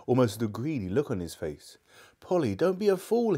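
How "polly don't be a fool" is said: high, urgent narrated shout; shouted warning. A narrator's reading. Diggory warns Polly off the rings, shouting. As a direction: cry out in alarm, pitched well up. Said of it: "he shouted".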